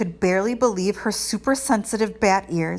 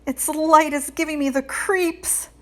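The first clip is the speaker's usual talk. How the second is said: shivering; 8 semitones higher than usual. A narrator's reading.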